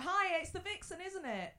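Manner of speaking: imitated eager fan; approaching fan. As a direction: hail a TV star you half know. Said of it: "Or they come up and go".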